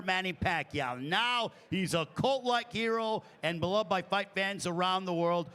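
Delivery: ordinary talk, nothing marked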